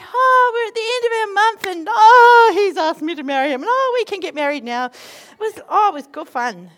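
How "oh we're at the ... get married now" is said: high, loud, fast gushing; mock ecstatic prayer. Parodying the couple's prayer, ecstatic gushing. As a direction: gush out the answered prayer.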